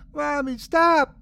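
high-pitched desperate plea; high, pleading child's voice; child crying out. A narrator's reading. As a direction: cry out as a frightened little girl. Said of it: "Myrtle cried".

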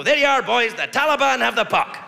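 rally the boys with mock encouragement; lightly voiced army commander. Army handing troops hockey sticks, lightly acted rallying call. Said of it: "They should just give their guys hockey sticks and go".

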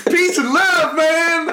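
high-pitched, hammed-up hippie slogan; put-on peace-loving protester voice; mock hippie. Bandana-wearing teacher preaching to pupils, mock hippie. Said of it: "I put my bandana on and go".

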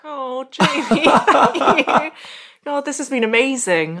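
high, laughing thanks; real delighted laugh of thanks; touched laugh. Real, not acted. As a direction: laugh it out, moved and grateful.

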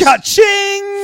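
cha-ching yelled high and loud; cash-register cry of glee, shouted high; excited shout. Real, not acted. Podcast hosts hyping free agency, excited shout.

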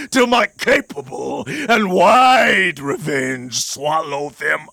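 raging vow. Acted. Othello swearing bloody revenge, raging vow. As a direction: drive each phrase like a wave.

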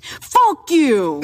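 high, loud mock outburst; defiant retort. Guest answers back to her inner voice, loud mock defiance. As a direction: hurl it back in exaggerated defiance.

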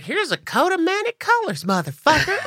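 high sweet Southern voice cursing. Pretend. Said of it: "read me to filth in [name] voice".

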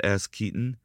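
usual speaking voice

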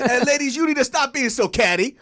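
high, loud pulpit admonishment; mock preacher. Preacher addressing the ladies, high loud mock admonishing. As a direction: scold the congregation in sermon style. Mock sermon with cat pun.

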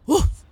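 loud, high imitated dog bark; a sudden sharp woof, imitating a dog. A narrator's reading. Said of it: "barked the dog".

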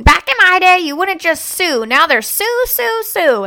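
high, loud mock grumble of an old man; a hammed-up gripe about everyone suing; imitating dad complaining. Daughter mimics her dad griping about lawsuits, high and loud. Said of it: "a video of him saying".

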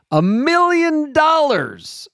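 high, slow drawn-out million dollars; Dr. Evil voice. Pretend. Dramatizing huge prize.

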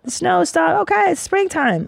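high chirpy gossiping bird voice; bird chatter. Retold or imitated. Birds sharing morning news, high chirpy voice. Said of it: "they're just like".

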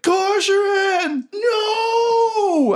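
high, drawn-out hammed-up wail; anguished cry. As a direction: overdramatic scream of grief. Villain cries out at comrade's death.

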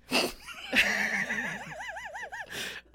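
high pained laugh fit; laughing through pain. Real, not acted. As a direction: laugh though it hurts to laugh. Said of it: "it hurts to laugh".

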